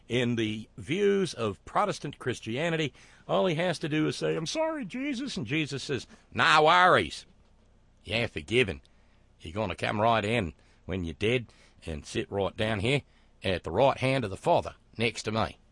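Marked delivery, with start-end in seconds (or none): 4.4-5.4 high-pitched little apology; put-on contrite sinner, voice jumping way up; mock penitent. Acts out a sinner apologizing to Jesus. 6.3-15.6 easygoing mock Australian accent; Australian Jesus. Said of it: "I don't know why Jesus is Australian this evening".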